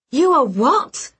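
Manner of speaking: high, loud, slow outraged question; can't believe what she heard; stunned outrage; shocked disbelief. Acted. Learns he is engaged.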